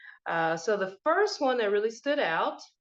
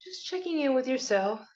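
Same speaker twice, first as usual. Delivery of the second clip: guided meditation; 9 semitones higher than usual. Acted. Host guiding listeners to find their breath, meditation voice. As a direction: lead listeners inward, leaving space after each prompt.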